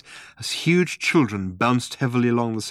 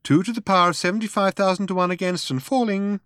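higher, composed announcing voice; sensible girl's voice; 9 semitones higher, faster than usual. A narrator's reading. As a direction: read out the odds sensibly, pitched up. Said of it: "Again came the voice".

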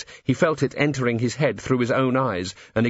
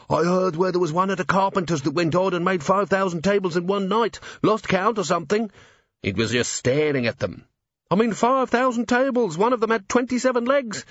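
higher, eager chatter; gossiping worker; 8 semitones higher than usual. A narrator's reading. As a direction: tell it as juicy gossip.

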